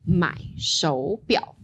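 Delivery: 买手表 said slowly, third tones clear; slow model phrase. Shown as a demonstration. Teacher models tone change slowly for student. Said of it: "that third tone remains".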